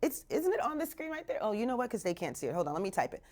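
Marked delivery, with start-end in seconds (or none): none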